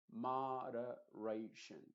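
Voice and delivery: moderation drawn out very slowly, syllable by syllable. Shown as a demonstration. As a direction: stretch each syllable of moderation. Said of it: "lo pronuncies sílaba por sílaba". (moderation muy lento, sílaba por sílaba)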